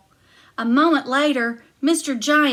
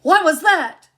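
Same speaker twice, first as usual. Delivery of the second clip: louder, higher startled giant; 6 semitones higher, 8 dB louder than usual. Acted. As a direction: bark out the question. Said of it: "cried the giant".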